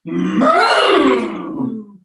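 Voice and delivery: loud, high, ferocious bull moo; angry moo. Shown as a demonstration. Teacher showing the class an angry bull moo. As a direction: bellow it like a furious bull. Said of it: "And an angry moo".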